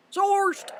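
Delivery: high, loud exclamation of "Sourced"; playful shout. Pretend. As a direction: blurt "Sourced" out as a loud cry. Repeats the word as a shout.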